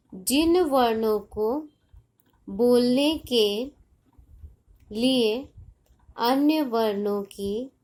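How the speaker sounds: slow, word groups parted by long pauses; slow dictation. Shown as a demonstration. Teacher dictating a definition to children, slow and measured. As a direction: dictate it piece by piece, stretching each phrase.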